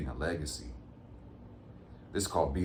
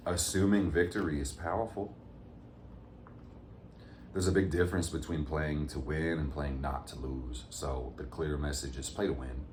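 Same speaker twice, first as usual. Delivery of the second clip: ordinary talk, nothing marked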